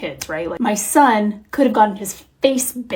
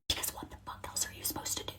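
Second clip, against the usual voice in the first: very quiet, quick whisper; hushed, hurried whisper to the listener; whispered aside; 24 dB quieter, faster than usual. Real, not acted. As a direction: whisper it fast, like a confidential aside. Whispered aside in story of yelling.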